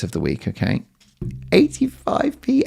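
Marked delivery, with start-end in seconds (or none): 1.5-2.6 far higher, slow falsetto; high falsetto price; squealed price. Acted. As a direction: squeak the price in giddy delight.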